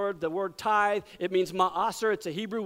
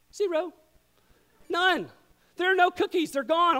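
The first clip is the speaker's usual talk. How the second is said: mock outrage; 7 semitones higher than usual. Real, not acted. Dad finds cookies gone, hammed-up outrage. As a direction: play the wronged dad in shock.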